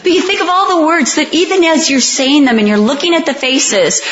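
usual speaking voice

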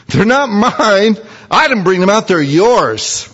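high, indignant protest; voicing Moses. Moses protesting to God, high and indignant. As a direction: push the people back onto God.

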